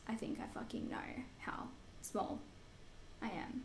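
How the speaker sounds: unvoiced fed-up aside; whisper. Retorting to people calling her tiny, whispered. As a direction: hiss it under breath.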